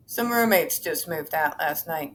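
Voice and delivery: normal talking voice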